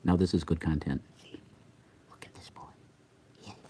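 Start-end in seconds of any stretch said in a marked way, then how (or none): 2.1-3.6 very soft, voiceless whisper. Real, not acted. Admiring the cat boy, whispered. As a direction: whisper it as if to the pet. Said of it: "Why is he whispering?".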